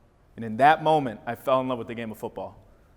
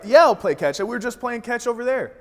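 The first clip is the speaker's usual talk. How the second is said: eager excited yes; 8 semitones higher, 4 dB louder than usual. Retold or imitated. Boy eagerly accepting dad's offer.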